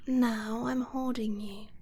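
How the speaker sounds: usual speaking voice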